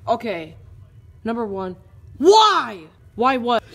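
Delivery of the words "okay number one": ordinary talk, nothing marked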